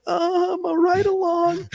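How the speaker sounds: put-on high, slow, far-off voice of a nervous kid; ride-along kid explaining himself to armed cops, meek and high; timid kid. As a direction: play the lost rookie, meek and slow. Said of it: "the kid's like".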